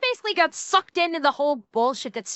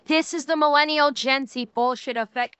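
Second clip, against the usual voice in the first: usual speaking voice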